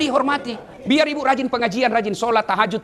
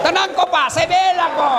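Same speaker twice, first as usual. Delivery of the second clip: high, loud call of cheerful support; mock rallying shout; 9 semitones higher, 4 dB louder, slower than usual. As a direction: shout it out like you're taking the husbands' side. Playfully defending husbands, calling out. (teriakan riang membela para suami)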